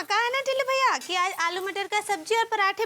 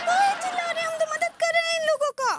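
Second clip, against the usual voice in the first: high-pitched, quick protest; indignant protest; 7 semitones higher, faster than usual. Acted. Wife protests at being shouted at. (ऊँचे सुर में शिकायत)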